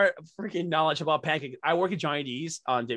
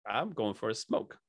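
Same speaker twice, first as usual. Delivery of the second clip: sheepish fib; 4 semitones lower, 7 dB quieter than usual. Retold or imitated. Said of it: "I'm like".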